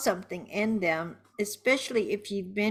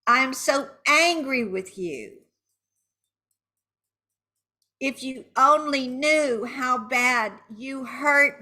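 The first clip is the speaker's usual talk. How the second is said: raised, higher-pitched hurt anger; angry venting; 5 semitones higher, 4 dB louder than usual. Shown as a demonstration. Venting at the cheater, angry and hurt. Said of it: "saying angry words now".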